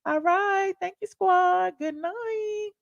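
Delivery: high-pitched, playful good night; high little goodbye voice; cutesy sign-off. Pretend. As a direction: bid the viewers farewell in a tiny high voice. Cutesy farewell to the audience.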